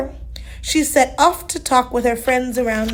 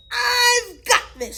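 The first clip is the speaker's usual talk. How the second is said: shouting to herself; 10 semitones higher, slower than usual. Acted. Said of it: "she shouted to herself".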